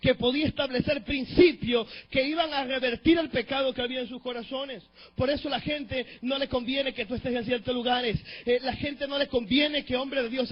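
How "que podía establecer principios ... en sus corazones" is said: loud, soaring preacher's cry; full-force preaching. Acted. Preacher rousing the congregation, at full force.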